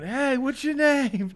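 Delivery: quoting a clip. Retold or imitated. Friend imitating a TikTok clip, slow loud goofy voice. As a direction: drag out a goofy greeting like the clip. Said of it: "just like that other one".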